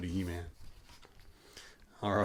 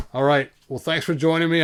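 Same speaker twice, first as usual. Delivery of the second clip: ordinary delivery, nothing marked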